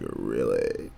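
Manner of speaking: low, soft, smooth-talking reply; deep voice. Retold or imitated. Streamer recalls girlfriend loving his voice, deep flattered reply. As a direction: drop it down low and quiet, playing up the compliment. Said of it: "deep voices are kinda cute".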